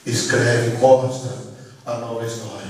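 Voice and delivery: very low, halting prayer of repentance; retold tearful plea. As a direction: beg forgiveness slowly, with long pauses. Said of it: "eu comecei a chorar e gritar".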